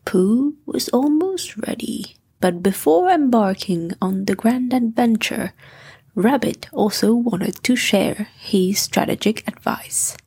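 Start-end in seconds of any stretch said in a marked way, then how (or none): none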